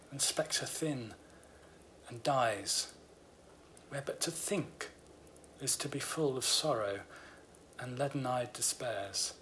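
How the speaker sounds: slow, hushed reading of a Romantic ode; poem recitation. Acted. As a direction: let each line sink, fading to a whisper at the end. Reads Keats ode aloud.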